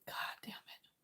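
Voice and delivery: a hushed curse under the breath; whispered curse while fighting tears. Real, not acted. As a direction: swear quietly to yourself. Cursing under breath while tearful.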